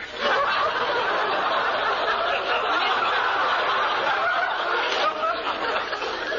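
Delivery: long breathy laugh, pitched high. Acted. Boss laughing at his own joke, long and high. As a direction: let a delighted laugh roll out.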